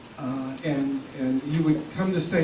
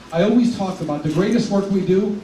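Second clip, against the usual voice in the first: normal talking voice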